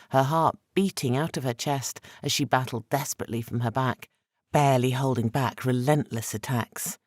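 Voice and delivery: ordinary delivery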